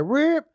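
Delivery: high, sudden dog squeal; imitated dog yelp. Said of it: "kicks my dog".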